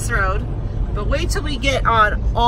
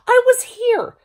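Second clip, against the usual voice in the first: high, louder indignant whine; indignant protest; 14 semitones higher, 5 dB louder than usual. Retold or imitated. Mom protesting to son about her spot. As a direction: squeal it like a wronged mom. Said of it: "I'm like".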